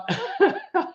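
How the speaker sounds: sudden high, quiet giggle. Real, not acted. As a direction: let a small embarrassed laugh slip. Giggles after burping.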